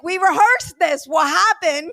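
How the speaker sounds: loud, high-pitched exasperated self-reproach; retold outburst, comically dismayed at yourself; losing it. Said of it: "I lose it".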